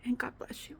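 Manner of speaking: hushed goodnight, barely voiced; whispered god bless to viewers. Real, not acted. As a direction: whisper it softly. Soft sign-off blessing.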